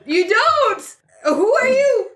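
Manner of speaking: high startled character voice. Acted.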